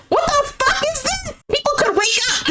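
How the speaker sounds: very high, squeaky disbelief; scandalized high-pitched outburst. Real, not acted. Mock outrage at public flirting.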